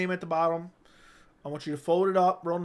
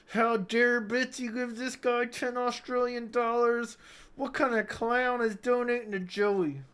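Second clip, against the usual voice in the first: higher, slow indignant whine; mock outraged hater; 6 semitones higher, slower than usual. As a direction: play the offended hater, dragging it out. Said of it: "They're like".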